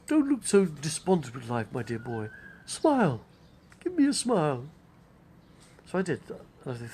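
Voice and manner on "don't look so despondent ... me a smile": Laurence Olivier. Retold or imitated. Olivier cheering up a tired young man, grand theatrical voice. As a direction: lilt it grandly, leaping up on the key words. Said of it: "He said".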